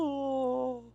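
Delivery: high, drawn-out mock howl; imitated Tarzan howl. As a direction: hold a high creature wail, played for laughs. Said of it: "It's like Tarzan".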